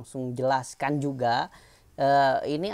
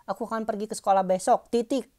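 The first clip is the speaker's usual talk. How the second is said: higher-pitched demo of "Aku akan pergi ke sekolah besok, titik"; spoken-out example sentence; 6 semitones higher than usual. Shown as a demonstration. Teacher shows class how the quoted line is spoken, raised demo voice. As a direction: say the example up high, voicing "titik" as a word. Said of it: "Kalau pas ngomongnya gitu".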